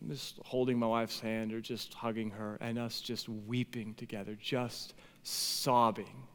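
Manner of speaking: low, soft, heavy-hearted telling; subdued grief, slowing on the hardest words; sombre recollection. Real, not acted. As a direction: recount a painful memory very quietly. Recalling grief over infertility.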